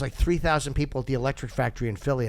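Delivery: ordinary delivery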